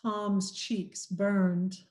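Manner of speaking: usual speaking voice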